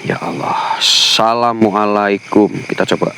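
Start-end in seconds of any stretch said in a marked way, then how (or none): 0.1-0.8 scared whisper. Real, not acted. Frightened ghost hunt at night.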